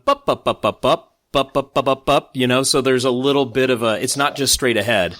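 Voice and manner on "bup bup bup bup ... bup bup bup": staccato "bup" syllables tapping out the beat; rhythm demo. Shown as a demonstration. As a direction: tap the meter out with bouncy "bup, bup". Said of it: "he's kinda going".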